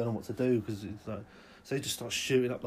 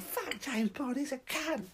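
crazed scorn; 12 semitones higher, slower than usual. Acted. Maniac dismissing film.

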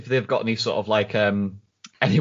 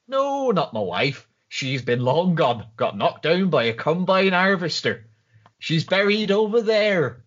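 Wurzel's voice; 5 semitones higher than usual. Retold or imitated. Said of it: "It's like".

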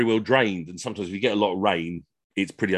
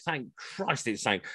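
usual speaking voice

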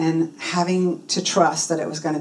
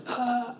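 high, soft gasp of astonishment; acted little gasp; stunned gasp; 5 semitones higher, 9 dB quieter than usual. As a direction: gasp as if amazed by the news. Stunned reaction to spiritual coach.

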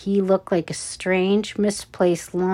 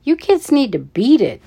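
higher, forceful telling-off; yelled order; 5 semitones higher, 4 dB louder than usual. A narrator's reading. Guard marches up to the scouts, yelled order. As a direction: yell it to chase kids away. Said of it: "the guard yelled".

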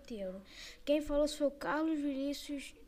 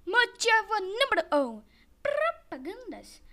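higher, louder headline call; announcing; 7 semitones higher, 8 dB louder than usual. Acted. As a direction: proclaim it like a title card. Announcing reason heading.

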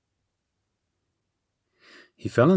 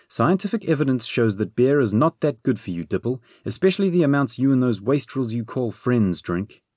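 higher-pitched nagging tirade; scolding Bernie, exasperated; 5 semitones higher than usual. Acted. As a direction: lecture the beer drinker in a raised pitch. Bernie's tirade about beer.

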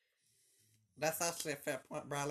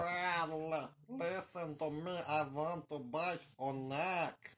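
put-on slow, heavy vampire accent; Transylvanian accent; much slower than usual. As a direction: menace it out like Dracula. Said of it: "you might hear my vampire accent".

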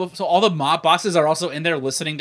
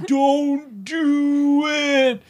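slow-motion voice; 8 semitones higher, much slower than usual. Retold or imitated. Slow-mo movie moment.

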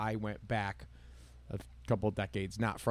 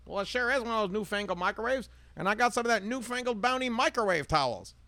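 high, chirpy ad-waitress voice; Rosie the waitress; 11 semitones higher than usual. Retold or imitated. Waitress Rosie pitching towels to her customer, high and chirpy. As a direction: sell it like a perky commercial waitress. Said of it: "Rosie's at the diner".

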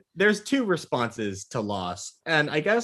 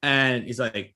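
ordinary talk, nothing marked

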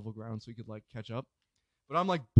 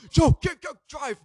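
high-pitched mock panic order; urgent getaway call; 11 semitones higher, 7 dB louder than usual. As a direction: urge your driver to go, acting it out. Urging getaway driver in story.

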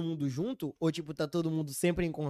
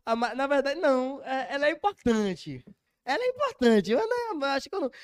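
flustered protest; 8 semitones higher, 4 dB louder than usual. Real, not acted.

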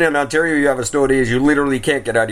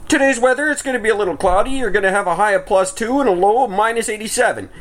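high, chirpy parody of a forecast; mock broadcast voice, pitched up; weather forecaster; 8 semitones higher than usual. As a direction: read it like an oblivious weather presenter. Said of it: "And it's always like".

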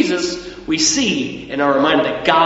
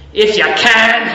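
mock-indignant old Scottish mover, louder; bad Scottish accent; 3 semitones higher, 5 dB louder, slower than usual. Old Scottish mover scoffing at the homeowner's doubt, imitated Scottish accent. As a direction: throw it back in a rough attempt at Scottish. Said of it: "not doing a good Scottish accent".

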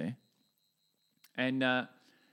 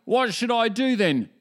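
hammed-up Aussie atheist; 10 semitones higher, 8 dB louder than usual. Atheist bloke asking how to pray, hammed-up broad Aussie accent. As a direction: play the gruff atheist bloke for laughs. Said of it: "This is Busco in the mornings".

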